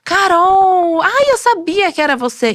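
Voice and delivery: put-on an older lady gushing at the checkout, excited call; excited recognition. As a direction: call out like a fan spotting a friend. Lady recognizing her at supermarket.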